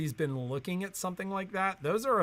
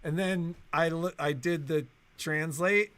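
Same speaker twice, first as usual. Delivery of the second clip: ordinary talk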